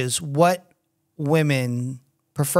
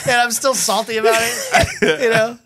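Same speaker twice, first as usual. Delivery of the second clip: higher, laughing mock indignation; laughing mock grudge; 5 semitones higher than usual. Server jokes as a grudging German refusing servicemen, laughing. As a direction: play the salty German, laughing. Joking in character as salty German.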